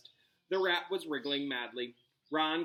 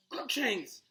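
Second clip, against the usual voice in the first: moaned; 7 semitones higher than usual. Acted. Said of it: "Hermione moaned".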